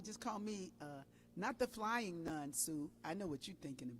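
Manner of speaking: ordinary talk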